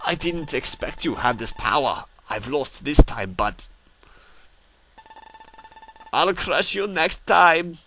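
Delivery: bad German accent, slow and menacing; a weak German villain accent. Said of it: "my German accent is horrible".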